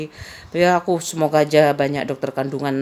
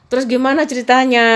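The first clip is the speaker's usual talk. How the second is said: eager friend; 6 semitones higher, 4 dB louder than usual. Retold or imitated. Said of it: "diceritain temen aku kayak itu".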